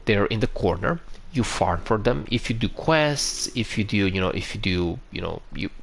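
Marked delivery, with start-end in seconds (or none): none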